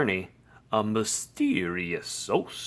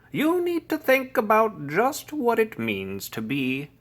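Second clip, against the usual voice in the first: lecturing; 6 semitones higher, 4 dB louder than usual. A narrator's reading. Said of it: "The lecture went on and on".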